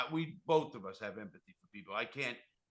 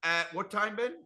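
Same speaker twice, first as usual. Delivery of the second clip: higher, louder announcer read; host plug read; 9 semitones higher, 12 dB louder than usual. Acted. Reminding listeners about his guest's new show, announcer voice.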